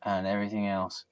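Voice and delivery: normal talking voice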